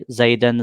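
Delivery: زیدن said with an -an ending, "zaidan"; zabar ending. Shown as a demonstration. Teacher showing changeable endings, زیدن ending in -an. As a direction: end زیدن on a short a plus n. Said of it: "کبھی زبر آئے گا".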